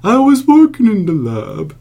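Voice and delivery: slow, high spooky crooner. Shown as a demonstration. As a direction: croon it like a campy horror host. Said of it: "kinda like that".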